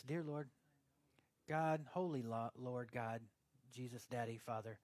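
hushed, low prayer piling up titles; mock prayer. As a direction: imitate an over-pious pray-er, pausing between names. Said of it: "you start praying like this".

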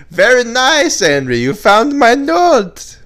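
high, loud mock foreign accent; hammed-up accented delight, last words drawn out; Borat. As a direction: exclaim it in a broad comic accent. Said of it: "It's me, Borat".

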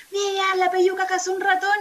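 high, loud call from the yard; mom yelling. Retold or imitated. Mom calling from the patio about the cat's catch, shouting. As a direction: shout the news across the house. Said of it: "en el patio y grita".

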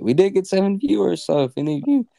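high, loud, hyped up; excited. Real, not acted. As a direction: pump up the tiny audience. Excited shout-out to viewers.

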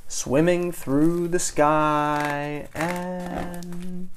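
floating storyteller suspense; suspenseful drawl. A narrator's reading. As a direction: draw each word out, holding the last. Narrator acts out drifting fall.

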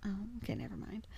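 quick, soft deflated aside; mock letdown. Let down by a departing new friend, quick quiet retreat. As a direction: drop to a small, hurried retreat.

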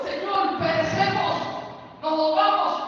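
high, loud frightened plea; panicked cry. Acted. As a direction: beg for rescue over the storm. Disciples cry out in storm.

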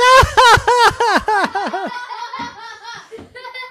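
high mock crowd laugh; hammed-up laughter. Farm friends laughing at Dora in the mud. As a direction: laugh big like the whole farm.

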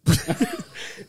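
shrieking laugh; laughing hard at a friend's joke; high burst of laughter. Real, not acted. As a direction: break into a squealing laugh.

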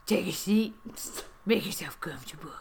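put-on Trump dropping to a whisper; bad Trump impression. Trump seats the guest in his suite, bad impression. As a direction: invite as an awful Trump, sinking into a whisper. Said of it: "I can't believe your impression is so awful".

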